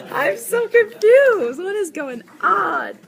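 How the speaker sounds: high, loud, drawn-out bewilderment; giggly, high-pitched exasperation; exaggerated confusion. Real, not acted. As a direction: wail it slowly and loudly. Giggling friends in mix-up.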